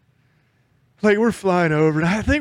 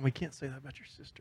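hushed; 2 semitones lower, 19 dB quieter, faster than usual. Real, not acted. Joking about friend's sister.

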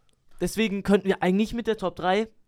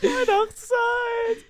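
stretched jingle; 12 semitones higher, much slower than usual. Pretend. Mock jingle.

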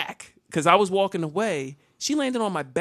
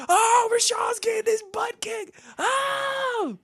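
very high, wailing mock crowd; crowd in chaos; slower than usual. Storyteller imitates cafeteria chaos during his beating, high crowd wail. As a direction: hoot like kids watching a fight. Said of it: "I could hear the chaos in the cafeteria".

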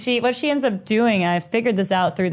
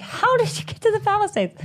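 very high incredulous question; retold astonishment; 13 semitones higher than usual. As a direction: replay your disbelief, squeaking upward. Retold astonishment at mother's trip.

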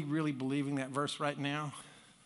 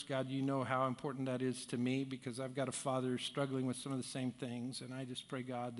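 prayer; 2 semitones lower than usual. Acted. Pastor's opening prayer.

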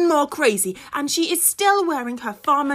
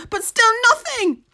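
ordinary delivery

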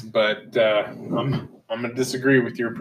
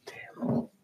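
whispered under the breath; hushed whisper; 14 dB quieter than usual. Shown as a demonstration. Repeats word, second whispered.

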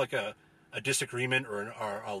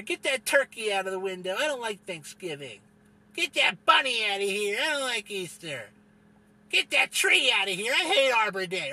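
higher whiny demands, getting louder towards the end; mocking holiday hater; 7 semitones higher, 4 dB louder than usual.

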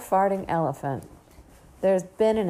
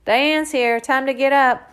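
raised, loud fatherly call; roaring; 8 semitones higher, 9 dB louder than usual. A narrator's reading. Father waking his daughter from downstairs, roaring. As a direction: roar it up the stairs. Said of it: "Daddy's voice roars up the stairs".

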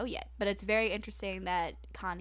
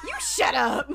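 high, loud retort; bickering yell, half joking; playful snapped comeback; 11 semitones higher, 7 dB louder than usual. Real, not acted. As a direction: fire it back in mock outrage. Bickering back at co-host.

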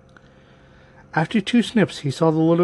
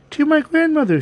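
put-on high, innocent little-girl voice; 12 semitones higher, 3 dB louder than usual. A narrator's reading. Girl thanking the wolf, high innocent voice. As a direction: answer politely in a high girlish pitch.

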